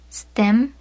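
normal talking voice

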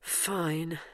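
soft grudging mutter. Acted. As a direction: mutter it under your breath. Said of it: "muttered Bernice".

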